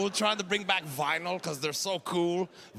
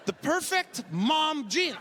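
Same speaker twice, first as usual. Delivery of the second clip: higher, slow mocking repeat of "The perfect mom jean"; mocking echo; 8 semitones higher, slower than usual. Shown as a demonstration.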